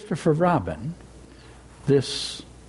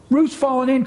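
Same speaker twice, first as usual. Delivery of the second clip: higher, louder alarmed cry; cried out; 9 semitones higher, 3 dB louder than usual. A narrator's reading. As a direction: call out the alarm. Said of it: "cried Rabbit".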